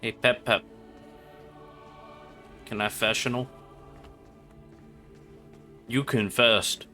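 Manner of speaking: usual speaking voice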